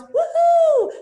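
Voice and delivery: very high, loud celebratory woohoo; joyful whoop. Real, not acted. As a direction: shout it like a win. Celebrating surgery approval.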